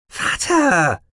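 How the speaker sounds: high called Vater; calling out. Acted. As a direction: call out sharply. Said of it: "rief Nies".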